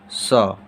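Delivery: सौ said clearly and low, as a model sound; letter sound demo. Shown as a demonstration. Teacher modelling सौ for beginners, clear and low. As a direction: model the sound सौ slowly for the class. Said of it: "इसका भी उच्चारण क्या होता है".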